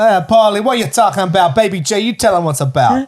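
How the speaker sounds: loud, high Boston mobster voice; Boston gangster. Acted. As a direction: push back like a Boston mob guy. They're all Boston gangsters.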